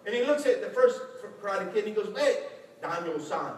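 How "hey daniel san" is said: Mr. Miyagi. Retold or imitated. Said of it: "it's like Mr. Miyagi".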